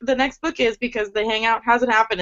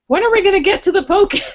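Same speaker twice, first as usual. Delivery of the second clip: heroine calling out; 9 semitones higher, 3 dB louder, faster than usual. A narrator's reading. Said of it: "her voice came out of the darkness".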